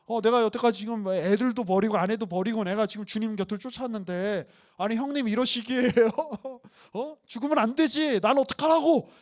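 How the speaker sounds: loud, aggrieved complaint, rising high at the end; hammed-up outrage at his master; indignant Peter. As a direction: fume like a betrayed follower. Peter's inner complaint acted.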